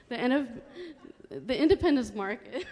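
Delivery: nervous laughing through words; giggling with nerves at the podium; stage fright. Real, not acted. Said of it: "I'm a little nervous".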